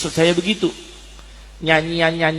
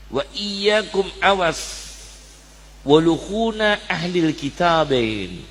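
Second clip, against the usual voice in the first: slow, deliberate Arabic quotation; slow hadith recitation; slower than usual. Acted. Preacher quotes the Prophet's warning to listeners, slow and deliberate. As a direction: lay out the warning word by word.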